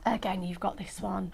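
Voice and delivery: breathy hushed whisper. Real, not acted. Host whispering over a pretty topper, hushed. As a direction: drop to a secret whisper. Showing craft toppers on air.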